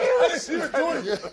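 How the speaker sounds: high-pitched, laughing plea of innocence; mock protest. Retelling his defence to the co-hosts, mock protest.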